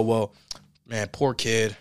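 ordinary talk

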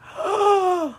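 sharp high gasp of surprise; gasp, caught off guard; moved gasp. Real, not acted. Hearing the stranger named his daughter after her crown, a gasp.

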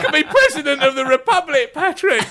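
high, strained squeak of devotion; imitating a voiceless, starstruck fan; lost-voice impression. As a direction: gush through a mock wrecked voice. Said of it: "I was talking like this all night".

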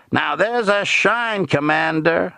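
high, drawn-out, pleased patter; servile shoeshiner's pleasure. A narrator's reading. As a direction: show off your work in a high, eager-to-please voice. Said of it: "Billy's face alight with simple pleasure".

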